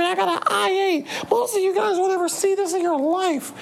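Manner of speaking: very high mocking little-girl voice; squeaky bratty kid bragging, imitated; high mock child voice flexing her clothes. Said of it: "I just seen a video today, like".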